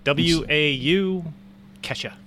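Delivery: "W A U" spelled letter by letter slowly, then "Kesha"; slowly sounds out "W A U Kesha". Real, not acted. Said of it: "not even gonna try to pronounce the name".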